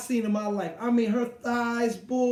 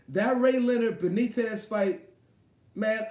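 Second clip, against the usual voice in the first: ordinary talk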